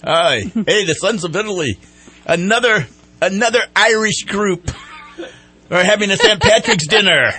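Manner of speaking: hammed-up Irish brogue, loud and lilting; a jolly Irish radio host's mock brogue. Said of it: "and my little Irish brogue here".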